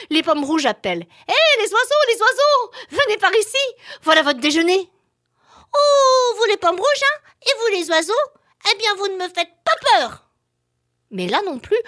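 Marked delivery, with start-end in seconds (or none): 1.3-4.9 high, loud calling out; calling apples. Acted. Apples call the birds. 5.8-10.2 high, loud cheeky little voice; a brave tiny flea, defiant; baby flea voice. Acted. Flea defies again.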